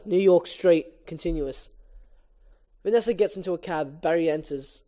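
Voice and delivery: ordinary delivery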